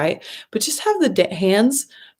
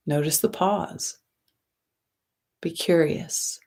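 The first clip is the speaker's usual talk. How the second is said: guided meditation. Acted. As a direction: ease them into the breath, gently and unhurried.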